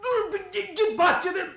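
high desperate shout, choked; gasping out a command. Acted. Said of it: "silkinerek bağırmış".

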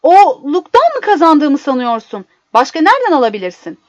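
loud, high indignant outburst; shouting. A narrator's reading. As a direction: shout it back in outrage. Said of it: "diye bağırdı".

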